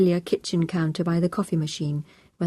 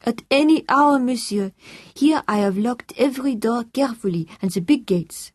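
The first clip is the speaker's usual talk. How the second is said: put-on raised, French-accented voice, loud at first; earnest French housekeeper; 6 semitones higher, 4 dB louder than usual. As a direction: play an eager, dutiful French housekeeper. Housekeeper reassures Tom on phone.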